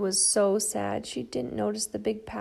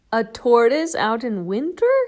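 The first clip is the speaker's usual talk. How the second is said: barking dog; 8 dB louder than usual. Acted. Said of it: "barked the dog".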